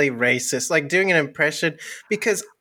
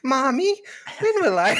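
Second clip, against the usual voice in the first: put-on higher, whiny little-boy voice; 8 semitones higher than usual. Comedian voicing a little boy asking his mommy. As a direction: play a small boy pestering his mom. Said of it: "Yeah, little boy".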